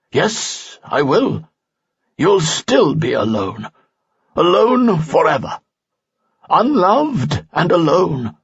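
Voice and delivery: put-on the old sage condemns the spirit slowly and sternly. A narrator's reading. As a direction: pronounce doom slowly like an elder. Falsafel's voice in story.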